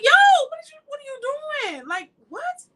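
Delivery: high, loud shout of disbelief; outraged yell at driver. Retold or imitated. Preacher yelling at a driver who nearly hit her, outraged. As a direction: yell it at the car that cut you off. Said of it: "I'm like".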